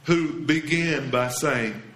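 normal talking voice

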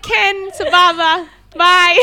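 mock yourself nodding along cluelessly, loud and slow; awkward cheery bluff. Passenger bluffing along with the bus driver, awkwardly cheery.

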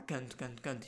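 usual speaking voice

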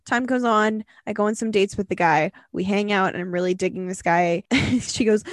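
ordinary delivery

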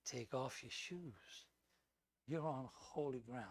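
a deep, awed whisper of God. Acted. God commanding Moses softly to remove his shoes. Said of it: "the voice that he heard was so incredibly powerful and strong and authoritative".

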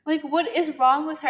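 exasperated outburst. Real, not acted. Venting anger at the author.

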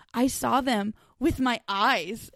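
slow, plain "I saw them with my eyes"; slow deadpan quote. Retold or imitated. Friend imitating Cha Nu's slow answer about the pyramids. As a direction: say it slowly and simply, like it's obvious. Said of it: "and he's just like".

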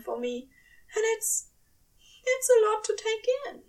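a fussy angel swamped by feeling; high, hurried, overwhelmed angel. A narrator's reading. Aziraphale voiced in story.

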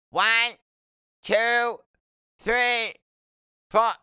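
put-on very high, loud kid voice, one word at a time; boy counting aloud. A narrator's reading. Boy counting for the nurse before surgery, high loud kid voice. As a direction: count out high and loud, pausing between numbers.

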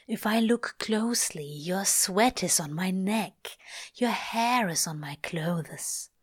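hushed, breathy whisper; inner whisper. Acted. Crowley answering the angel in a broken whisper. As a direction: confess it under your breath.

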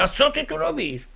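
high, startled cry of surprise; startled question. Retold or imitated. Husband startled as his wife walks in, high surprised cry. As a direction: caught off guard, blurting it out high. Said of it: "Ja mówię".

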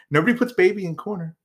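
mock movie quote, barely voiced; Johnny impression. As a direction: toss off the movie line fast and high. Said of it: "like Johnny looking at baby".

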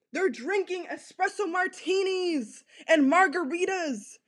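usual speaking voice

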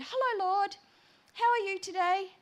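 high, loud, chirpy greeting; little bird voice. Retold or imitated. Entering church to greet the Lord, little bird voice. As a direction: sing out brightly like a small bird. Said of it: "same voice".

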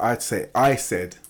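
ordinary talk

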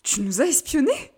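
taken aback, voice rising; shocked accusation. Acted. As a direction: ask it in scandalized disbelief. Shocked at sister's photos.

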